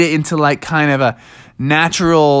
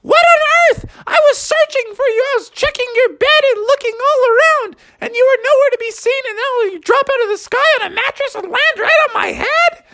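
very high, fast scolding mom voice. Acted. Mom scolding Froggy for landing on her head, very high. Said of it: "his mom poked his head out and said".

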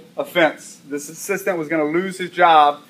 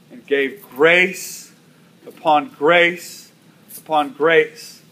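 slow emphasis; much slower than usual. Acted. Sermon climax phrase.

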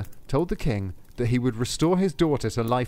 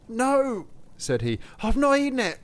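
stubborn denial; 13 semitones higher than usual. Acted. Soldier to Saint Peter, high defiant denial. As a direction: refuse to confess, desperate.

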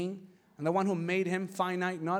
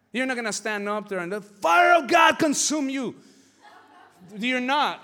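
loud, higher shouted preaching; 5 semitones higher, 8 dB louder than usual. Acted. Preacher warning the church about the mob, shouted. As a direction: thunder it at the congregation.

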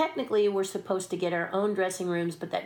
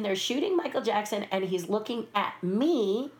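normal talking voice

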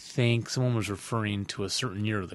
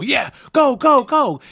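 much higher, louder mock cheer of go go go; imitating cheering; 13 semitones higher, 10 dB louder, slower than usual. Host retelling cheering on a rival's touchdown, mock cheer. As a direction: cheer a runner on as if at the game. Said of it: "I was started to go".

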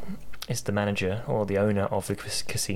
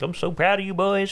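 high, proud fatherly voice; proud old kettle voice; 9 semitones higher, 3 dB louder than usual. Retold or imitated. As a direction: beam with pride at the boys. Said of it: "Kettlehead's like".

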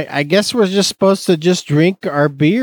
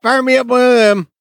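mock order; 11 semitones higher, 4 dB louder, faster than usual. Playing demanding bar customer.

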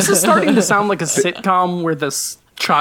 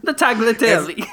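higher-pitched playful fake Italian; mock Italian accent; 6 semitones higher than usual. Friend joining the dad-ordering joke, mock Italian accent. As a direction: say it with a showy Italian flourish, amused. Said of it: "try and say the words".

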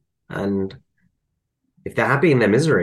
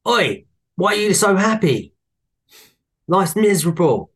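put-on higher-pitched grumpy old lady voice, a touch louder; 9 semitones higher, 3 dB louder than usual. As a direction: lightly voice a sour neighbour. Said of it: "this lady goes".

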